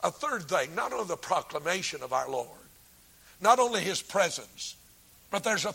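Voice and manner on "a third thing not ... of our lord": ordinary talk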